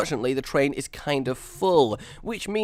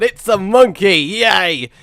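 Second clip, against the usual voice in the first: louder, higher burst of glee; cheering; 6 semitones higher, 9 dB louder, slower than usual. Real, not acted. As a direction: cheer your silly creation. Cheering own monkey build.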